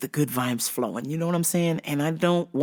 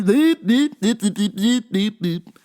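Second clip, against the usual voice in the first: high chirpy beep noises; mock beeping; 5 semitones higher, 4 dB louder, slower than usual. Mocking the old man as a beeping gadget. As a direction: beep like a frantic little machine.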